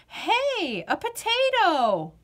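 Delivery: put-on high, bright kid surprise; surprised discovery. Girl noticing a potato on the ground, surprised. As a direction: spot something unexpected.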